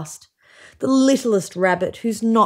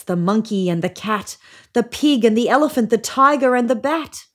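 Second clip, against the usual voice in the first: ordinary delivery, nothing marked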